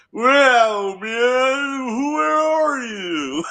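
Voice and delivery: high, slow, calling out spaced-out hippie; stoner trope voice. Pretend. Mocking the old [name] trope, high slow spaced-out call. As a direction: stretch it out like a lost stoner yelling. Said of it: "Oh, that old trope".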